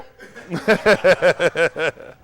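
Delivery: long run of chuckles; real, rolling laugh; hearty laugh. Real, not acted. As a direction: laugh it out in a steady burst. Host cracks up.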